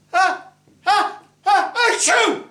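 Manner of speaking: high, loud hammed-up build to a sneeze; high mock sneeze. Bride sneezing after dust, high loud mock sneeze. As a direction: wind up the sneeze high and loud, then burst. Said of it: "and the bride went".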